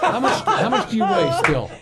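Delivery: high, loud laughing jab; laughing tease. Real, not acted. Teasing, laughing.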